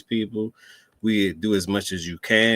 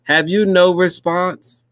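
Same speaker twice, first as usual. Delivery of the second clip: higher, louder and slow mock British reporter; mock British accent; 9 semitones higher, 7 dB louder, slower than usual. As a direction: ham up a posh English reporter, slow and high. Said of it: "You gotta start talking like this".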